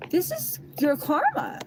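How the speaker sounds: accusing him of his karma; retold angry retort. Retelling her retort to him.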